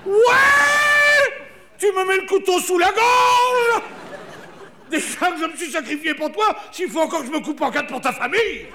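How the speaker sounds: high shouting angry wife; furious wife. Pretend. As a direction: shriek at your husband, outraged. La femme furieuse crie. (épouse furieuse qui hurle)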